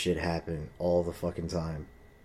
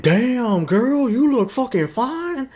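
very high, slow, loud sleazy catcall; mock catcall; 10 dB louder, much slower than usual. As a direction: drag out a cartoonish pickup line. Parodying a catcall.

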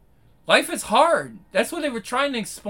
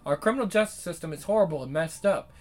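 quick, low, even read of a written essay; reading someone's article aloud to viewers; 4 semitones lower, 5 dB quieter, faster than usual. Acted. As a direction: read the piece through at a steady clip.

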